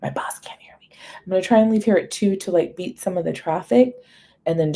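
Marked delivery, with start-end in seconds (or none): 0.0-1.3 real whisper so the boss can't hear. Real, not acted. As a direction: hush it, voiceless and secretive. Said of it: "Why am I whispering?".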